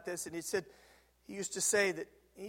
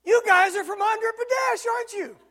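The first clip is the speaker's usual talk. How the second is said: much higher and louder friendly hail; walk up and announce it to them; bold call-out; 14 semitones higher, 11 dB louder than usual. Retold or imitated. Calling to strangers at table.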